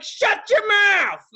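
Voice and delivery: high, slow mimicking whine; a shrill taunting copy of the guard; mocking echo. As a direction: parrot the guard back mockingly. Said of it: "I'm like".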